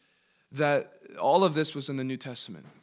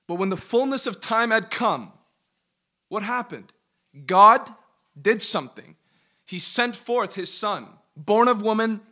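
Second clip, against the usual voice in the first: loud, raised preacher's delivery; impassioned preaching; 7 semitones higher, 8 dB louder than usual. Acted. Preacher reads Galatians to his Bible class, impassioned preaching. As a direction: preach it out, punching key words hard. Preaches the verse at full force.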